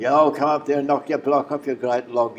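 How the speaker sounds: high, loud taunting yell; mock taunt. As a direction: goad the wrestler to fight. Tommy taunts the wrestler.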